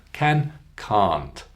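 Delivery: "Can" short, "can't" a long cahnt; short then long. Shown as a demonstration. Teacher contrasts for practice, short "Can", long "can't". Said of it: "Can you hear the difference in length?".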